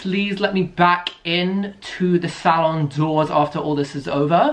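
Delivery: normal talking voice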